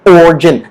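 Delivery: "origin" in two syllables, "OR-jin"; silent I. Shown as a demonstration. Teacher corrects learners, "origin" said "OR-jin". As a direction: drop the middle i of "origin". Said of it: "The I is a silent I".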